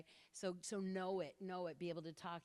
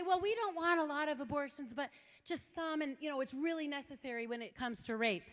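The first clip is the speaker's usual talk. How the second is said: high, louder imitation of the petitioner; mimicking him, hammed-up and higher; mock young man; 8 semitones higher, 6 dB louder than usual. Speaker imitating the petitioner defending abortion, high and loud. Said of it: "And he tried to say".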